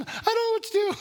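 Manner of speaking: teasing impression of a frazzled wife at the wheel; imitating his wife. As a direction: squeak it out like a helpless wife. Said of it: "she's saying".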